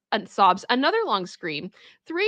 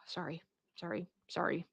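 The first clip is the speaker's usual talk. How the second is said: sheepish apology; 4 semitones lower, 16 dB quieter than usual. Real, not acted. Recovering after outburst.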